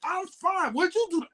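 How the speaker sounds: imitating a bubbly phone answer; high upbeat reply. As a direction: keep the voice up high and bright. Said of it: "I'm matching all of that".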